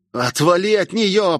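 медленный рык, выше обычного; прорычи с напором, растягивая слова. A narrator's reading. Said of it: "прорычал Ямада". (злобно рычать)

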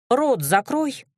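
brisk order to a subordinate; commanding. A narrator's reading. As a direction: give a curt command. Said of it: "велела я".

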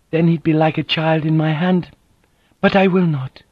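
a dignified woman, hurt and firm; proud wounded noblewoman. Acted.

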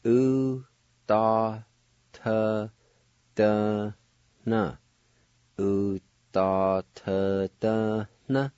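teacher modelling "U, da, ta, da, na" slowly in parts; syllable by syllable. Shown as a demonstration. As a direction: sound out "U, da, ta, da, na" one syllable at a time.

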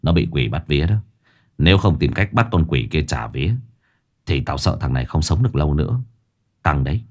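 low-pitched grim elder voice; Lão Năm, grave. Acted. Lão Năm warning Sáu of the demon, grave low voice. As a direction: explain the danger low and seriously.

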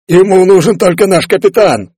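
high-pitched, forceful bellow; bellowing roar. A narrator's reading. Boatswain calls out as monster nears, bellowing. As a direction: roar it over the commotion. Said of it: "проревел боцман".

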